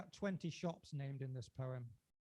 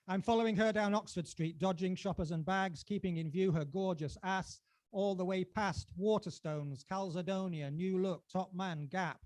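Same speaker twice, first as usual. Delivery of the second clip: louder, lively spoken-word telling; performance poetry; 5 semitones higher, 9 dB louder than usual. Acted. Poet reciting Oxford Street Blues to the crowd, lively. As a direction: drive the story forward like a chase.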